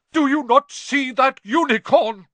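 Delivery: high, very loud angry bellow. A narrator's reading. Centaur challenging another over the slain unicorn, bellowing. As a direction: bellow the question in fury. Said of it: "Firenze bellowed at Bane".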